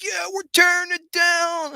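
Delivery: high, slow, gleeful mock cheer; mock destroyer. Mocking how his project sounds to critics, with gleeful high cheer. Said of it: "makes it sound negative".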